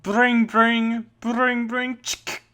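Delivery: high, sung brring brring of a ringing phone; phone ring imitation. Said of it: "do the ringing noise for me".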